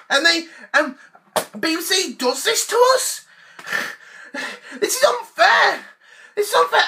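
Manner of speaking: whiny tantrum. Acted. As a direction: complain like a spoiled kid. Ranting, building to tantrum.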